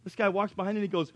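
ordinary delivery

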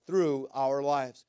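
loud, ringing preacher's voice; full-force preaching. Acted. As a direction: proclaim it to the whole congregation.

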